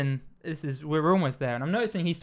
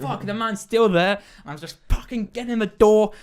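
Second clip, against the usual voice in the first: louder, higher retold frustration; exasperated; 6 semitones higher, 5 dB louder than usual. Embarrassed guest stuck in revolving door, exasperated retelling. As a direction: relive panicky exasperation at being trapped.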